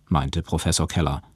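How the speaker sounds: plain reading voice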